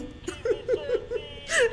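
shrill frightened giggle; half laugh, half whimper; high nervous laugh. Real, not acted. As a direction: laugh out the nerves. Laughing in fear at game.

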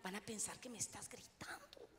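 soft unvoiced whisper; husband whispers a warning to his wife. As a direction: hush her so the children don't hear. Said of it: "Sí, niños".

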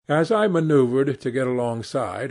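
ordinary delivery

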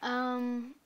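normal talking voice

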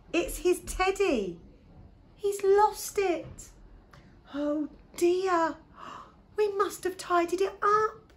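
high, drawn-out dismayed calling. A narrator's reading. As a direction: call it out high over a crying baby. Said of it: "shouted Gran, covering her ears".

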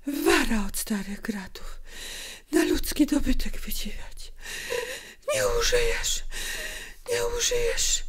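bitter, exhausted whisper; spent, breathy muttered threats. A narrator's reading. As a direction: whisper curses in broken fragments. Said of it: "szeptała".

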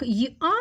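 "й-а" split into й and а; "й-а" as a glide, then a clear vowel; two separate sounds. Shown as a demonstration. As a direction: sound out "й-а" piece by piece. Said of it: "як я вимовляю я".

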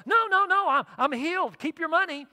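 high, loud insistent refusal; eager refusal. Retold or imitated. Healed man refusing a tossed dollar, high and loud. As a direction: wave the money off, insisting.

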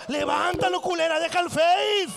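building to a loud holler; exasperated scolding. Acted. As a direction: yell it in mock outrage. Scolding the mother, acted outrage.

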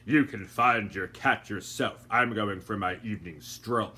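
a hint of a slow, aloof older man; slow, unbothered gentleman voice; lightly voiced gentleman. A narrator's reading. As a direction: say it unhurried, brushing her off. Doctor Fogg voiced.

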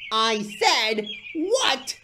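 high, loud, furious demand; cross shout with pauses for force. A narrator's reading. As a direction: shout it slowly, word by word, in outrage. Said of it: "he wheezed crossly".

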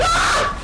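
very high, loud shriek; panicked scream. Real, not acted. Gamer caught by danger in game, screaming. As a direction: scream out in alarm.

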